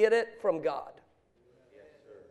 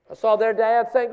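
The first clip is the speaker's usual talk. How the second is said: ordinary delivery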